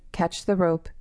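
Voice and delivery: ordinary delivery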